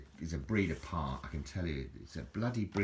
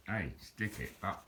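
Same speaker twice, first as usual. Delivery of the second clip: Alan Partridge; 7 dB quieter, slower than usual. Retold or imitated. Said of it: "Alan Partridge".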